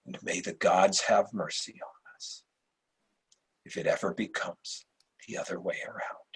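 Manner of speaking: grim hush. Acted. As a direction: voice sinking to a whisper at the end. Emperor's solemn grim jest.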